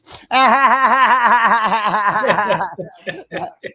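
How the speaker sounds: loud, high burst of laughter; big laugh. Real, not acted. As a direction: let a long real laugh roll out. Host cracks up laughing.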